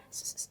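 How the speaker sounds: soft hushing shh. Shown as a demonstration.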